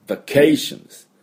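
vacation with CAY high and stretched; stress on CAY. Shown as a demonstration. Teacher showing where the accent falls, CAY stressed high. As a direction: punch the second syllable of vacation, va-CAY-tion. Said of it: "vacayのところにアクセントがあります".

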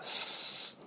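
drawn-out voiceless "sss"; hissing S. Shown as a demonstration. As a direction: let air leak out in a hiss. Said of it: "タイヤのチューブで針を穴を開けた". (タイヤから空気が漏れる「スー」)

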